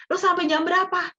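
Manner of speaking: high-pitched quoted query; mock quizzing, pitched well up; questioning. Imagined questioner asking Dian until what hour, questioning.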